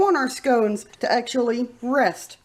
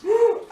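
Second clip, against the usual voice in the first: quick startled gasp, high; sharp surprised gasp; 11 semitones higher, 5 dB louder than usual. Real, not acted. Spotting a spider on the scones, a sharp gasp. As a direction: catch your breath at a spider.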